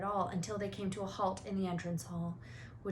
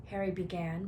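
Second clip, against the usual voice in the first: ordinary delivery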